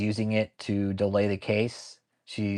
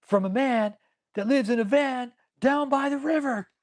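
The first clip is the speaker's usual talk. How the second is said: goofy imitation; 13 semitones higher, 3 dB louder than usual. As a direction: ham it up in a high voice. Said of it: "He's like".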